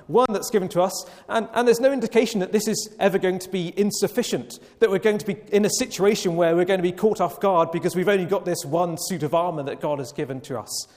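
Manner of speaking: ordinary delivery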